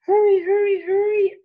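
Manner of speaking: high, loud urging; urgent. Acted. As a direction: hurry them on with alarm. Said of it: "urged Bear".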